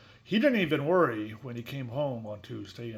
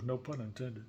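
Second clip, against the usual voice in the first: ordinary delivery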